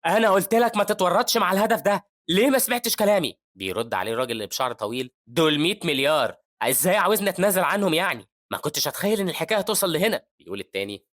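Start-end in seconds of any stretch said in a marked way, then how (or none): 0.1-3.4 loud, high scolding; angry yelling. Acted. Hero at home scolds the gang over the target, yelling. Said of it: "بطلنا بيزعق في البيت وبيقول". 5.3-10.2 high, loud protest; heated arguing back. Acted. Long-haired man defends himself to the yelling hero, heated.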